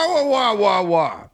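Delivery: high whining wah wah, mimicking the doorman; hammed-up imitation of a whining attendant; mocking whiny guy. Imitating the stadium guy's whining to friends. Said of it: "This is how it sounds".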